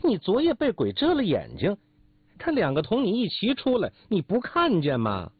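high-pitched angry retort; heated comeback, pitched well up; yelling back. Acted. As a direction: shout the accusation back, indignant. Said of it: "也嚷着说".

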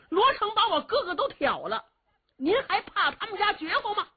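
high, sharp angry retort with a scoffing laugh. Acted. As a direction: throw it back at the old man, high and indignant. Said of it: "越听越有气呀". (又气又讥讽地高声反问)

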